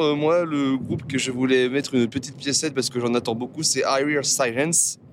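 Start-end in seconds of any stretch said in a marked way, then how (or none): 3.8-5.0 slow, careful English pronunciation of I Hear Sirens; English accent. Shown as a demonstration. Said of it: "avec mon magnifique accent anglais".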